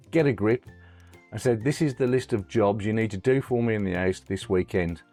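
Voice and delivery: normal talking voice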